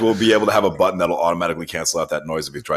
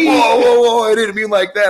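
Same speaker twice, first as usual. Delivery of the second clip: higher, louder imitated panic; startled customer; 7 dB louder than usual. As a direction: play the startled man yelping whoa. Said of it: "He's like".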